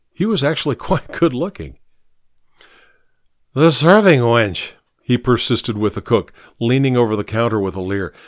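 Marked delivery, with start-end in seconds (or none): 3.5-4.7 put-on drunk man. Drunk persists with the cook.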